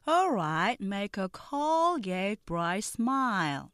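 very slow, careful English dialogue; slow pronunciation drill; slow model read. Shown as a demonstration. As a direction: read each line slowly for learners to repeat. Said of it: "느린 속도 발음 듣고 천천히".

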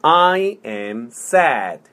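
slow, word by word; slow model. Shown as a demonstration. Teacher modelling "I am sad" for repetition, slowly. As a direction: space out each word for a learner. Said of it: "천천히. 자, 해볼게요".

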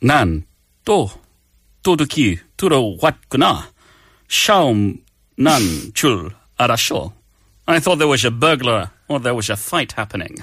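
put-on Korean in a slushy Scottish film-star accent; halting, put-on Scottish lilt; Sean Connery. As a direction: play the spouse as a suave spy. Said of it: "with the Sean Connery accent".

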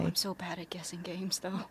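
soft unvoiced aside; self-deprecating whisper. Real, not acted. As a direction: whisper it to yourself. Nervous aside.

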